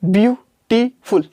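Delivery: Beautiful said slowly in three beats; slowed into syllables. Shown as a demonstration. Teacher to class, beautiful broken into three slow beats. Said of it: "इस तरीके से तीन बार में बोला जा रहा है".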